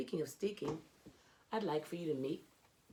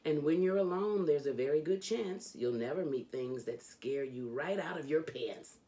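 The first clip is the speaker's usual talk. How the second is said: lively, sing-song reading of a rhyming picture book; animated children's book read-aloud; storybook reading; 2 semitones higher, slower than usual. Acted. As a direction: read it like a bedtime story for a kid. Reads Dr. Seuss aloud.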